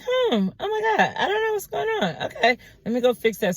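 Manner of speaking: high, fast, fluttery waitress voice; flustered waitress. Retold or imitated. Quoting the waitress caught overcharging, high and rushed. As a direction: squeal it out like a frazzled server caught in a mistake.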